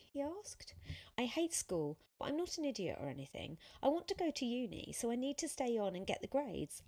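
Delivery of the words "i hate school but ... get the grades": louder, higher, hurried outpouring; venting. A narrator's reading. Sophia explaining her frustration to Tom, venting. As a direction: rush through the explanation earnestly.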